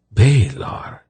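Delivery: ordinary delivery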